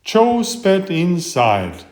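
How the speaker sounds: plain reading voice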